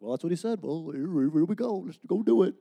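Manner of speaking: a mock gung-ho voice, high and loud; mindless eager tone. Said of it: "You'd have to say it in that tone of voice".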